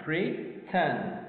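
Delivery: "pretend" broken into pre and tend; syllable by syllable. Shown as a demonstration. Teacher showing learners two syllables, syllable by syllable. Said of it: "We got two".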